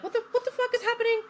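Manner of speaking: high, rushed mock panic; retold panic. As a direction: blurt it out fast, acting bewildered. Retold panic as alarm is raised.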